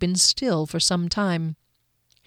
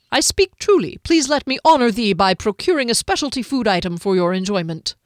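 raised, swooping florid plea; courtly, earnest halfling; 3 semitones higher, 4 dB louder than usual. Acted. Halfling begging to honor his lady, courtly and earnest. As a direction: press the offer with flowery courtesy.

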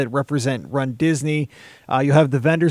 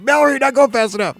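much higher, louder pretend yell; mock yelling; 11 semitones higher, 5 dB louder than usual. Parent joking about yelling at a running kid, mock yell. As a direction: jokingly holler like a pushy parent. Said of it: "I was yelling at my kid".

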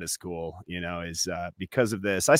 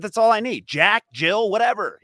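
mock outraged yell; 10 semitones higher, 6 dB louder than usual. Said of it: "They said".

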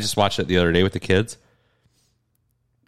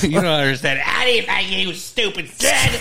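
mock sobbing rant; 9 semitones higher than usual. Mock dad sobbing to kids.

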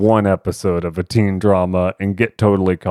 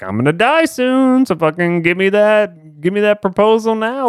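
much higher, eager imitation of an old grandma; hammed-up grandma urging the announcement; pushy grandma; 10 semitones higher than usual. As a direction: demand the proposal now like an impatient granny. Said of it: "she's like".

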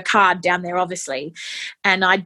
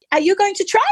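nurse's question; 11 semitones higher, 4 dB louder, faster than usual. Retold or imitated. Nurse asks, voiced high.

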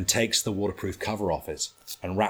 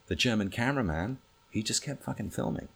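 ordinary delivery

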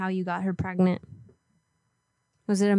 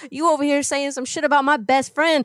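put-on tough-girl threat; 3 semitones higher than usual. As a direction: talk trash like a street fighter. Prank caller threatens to pop him.

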